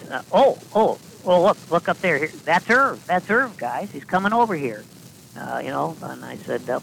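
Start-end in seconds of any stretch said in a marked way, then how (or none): 0.3-4.8 high, loud excited pointing. Acted. Coach showing kids their sponsor arriving, excited. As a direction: hype the kids up about Irv's arrival.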